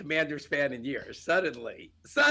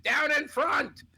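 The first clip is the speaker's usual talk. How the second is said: high, drawn-out heckler call; retold crowd heckle, pitched way up and slow; thrown voice; 11 semitones higher, slower than usual. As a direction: throw your voice high, stretching each word. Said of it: "I tried to throw my voice".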